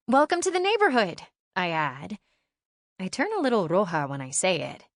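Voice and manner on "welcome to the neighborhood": put-on eager girl's greeting. Shy girl greets new neighbor.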